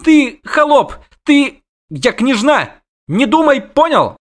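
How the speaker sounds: very high, loud princess tantrum; shrieking indignation. A narrator's reading. Princess to the witcher who grabbed her, shrieking indignation. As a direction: splutter insults at the top of the range. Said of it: "взвизгнула она".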